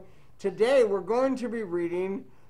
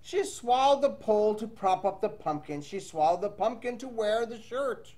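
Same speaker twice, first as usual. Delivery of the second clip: lively read-aloud storytelling voice; reading to children. Acted. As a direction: read the rhyme to young kids. Teacher reads picture book aloud.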